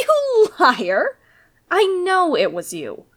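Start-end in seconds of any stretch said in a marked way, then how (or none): 0.1-1.2 a scornful laugh, then a high you liar; laughing accusation. Acted. The bandit laughs at the lord's lie, scornful and accusing. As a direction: laugh in his face and call him out. Bandit catches lord lying.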